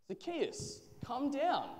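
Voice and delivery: high-pitched call. A narrator's reading. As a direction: call up to someone in a tree. Jesus calls up into the tree.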